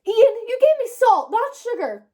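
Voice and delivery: high, loud cry of dismay at her brother. A narrator's reading. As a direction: shout it out, upset. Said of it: "Olivia cried".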